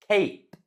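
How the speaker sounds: cape with long ay sound. Shown as a demonstration. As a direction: glide cape into ay. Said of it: "you say them differently".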